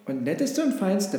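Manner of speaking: a hint of a bleating sheep. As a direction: carry on the list like an irked sheep. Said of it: "blökte das Schaf weiter".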